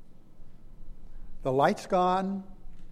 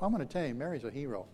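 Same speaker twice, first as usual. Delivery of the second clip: ordinary delivery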